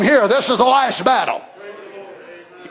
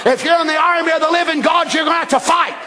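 fiery preaching; 6 semitones higher than usual. Real, not acted. Preacher shouting at the church about fighting, fiery. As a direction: hammer the point home.

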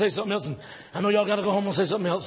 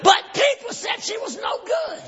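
impassioned shouted sermon, peaking high; full-force preaching; 5 semitones higher than usual. Acted. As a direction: rouse the congregation with each phrase.

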